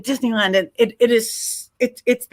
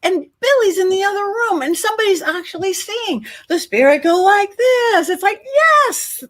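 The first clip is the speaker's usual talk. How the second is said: excited; 9 semitones higher than usual. Real, not acted. Excited recall.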